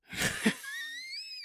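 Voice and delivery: high, squealing burst of laughter; high shrieking laugh. Retold or imitated. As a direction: let out a shrill squeal of a laugh. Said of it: "He starts laughing like me".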